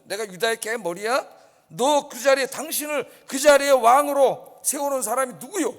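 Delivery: builds to a loud angry demand; who put you on that throne; angry general. Acted. General shouting at the young king, angry. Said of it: "아브넬이 화가 났어요".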